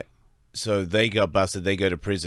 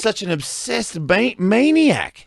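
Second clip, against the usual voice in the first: outraged exclamation; 12 semitones higher, 5 dB louder, slower than usual. Real, not acted. Outraged reaction to trafficker.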